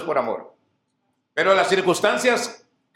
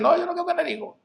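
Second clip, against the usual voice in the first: mock woman; 11 semitones higher, much faster than usual. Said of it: "ahora ya dicen".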